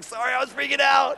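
high-pitched mock apology; comic squirming excuse to God; sheepish apology.

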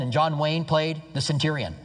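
normal talking voice

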